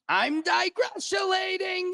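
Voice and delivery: high, slow, soft falsetto squeal; silly falsetto. Pretend. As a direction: camp up the made-up word. Silly made-up word, comic.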